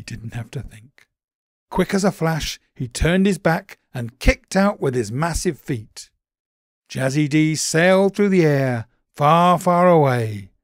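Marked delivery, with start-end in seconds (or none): 1.7-10.5 excited narration. A narrator's reading.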